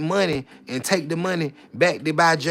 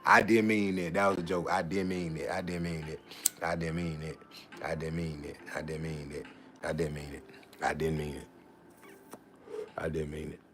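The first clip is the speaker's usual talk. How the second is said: mumbled apology; 12 semitones lower, 11 dB quieter than usual. Real, not acted.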